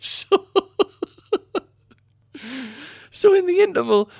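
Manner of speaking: high giggling laughter; laughing. Real, not acted. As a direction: laugh through it. Bursting into laughter.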